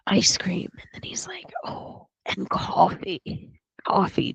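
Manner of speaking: put-on a shocked boy whispering; whispered child request. Boy in the hospital asking for treats, whispered.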